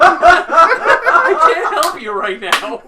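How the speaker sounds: very high, very loud, drawn-out wail; slow laughing shriek; mock desperate yell. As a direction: scream it at a friend, hammed up. Acted player yelling at friend.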